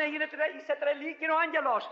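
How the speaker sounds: high, quick retold quarrel; mock shouting match. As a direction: act out the brothers arguing. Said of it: "Τούτοι φωνάζανε".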